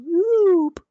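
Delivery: sudden high, loud yelp; startled whoop. Real, not acted. Sudden slip while building.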